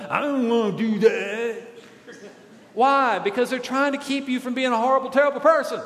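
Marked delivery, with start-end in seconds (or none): 0.1-1.7 mock childish whine; whining kid. Kid refusing parents' Bible reading, mock whining.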